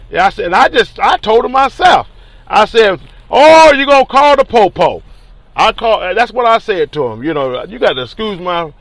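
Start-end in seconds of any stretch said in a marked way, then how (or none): 3.3-5.0 retold taunt, high and loud. Man retelling his taunt at police officers out front, loud. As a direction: call them out loudly, drawing out the "Oh". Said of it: "I said it loud in front of them".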